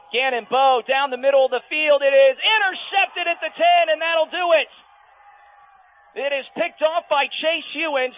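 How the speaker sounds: high, loud game-winning call; excited play-by-play. Acted. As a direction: shout the interception call at full excitement.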